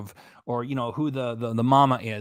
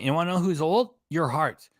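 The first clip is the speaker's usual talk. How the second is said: ordinary talk, nothing marked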